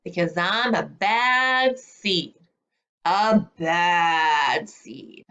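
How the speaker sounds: very slow, drawn-out bad seed; slow bad seed refrain. A narrator's reading. Seed explains why he misbehaves, slow and drawn out. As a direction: stretch each word like a proud troublemaker.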